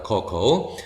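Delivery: "커코우" with a weak "uh", then "koh"; unstressed schwa. Shown as a demonstration. Teacher showing the English way, weakened first vowel in "커코우". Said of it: "액센트가 없거든요".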